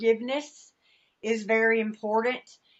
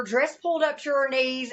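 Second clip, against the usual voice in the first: normal talking voice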